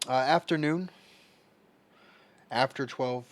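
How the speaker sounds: loud reply across the house; calling out. Real, not acted. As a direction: shout the answer to mom in another room. Answering mother across the room.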